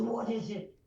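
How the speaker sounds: loud reply from an Irish drinker; punchy Irish dialect; Irish accent. Shown as a demonstration. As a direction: answer loudly in an Irish brogue. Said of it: "let's see if I can do it".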